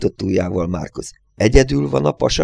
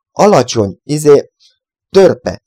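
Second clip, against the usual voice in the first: usual speaking voice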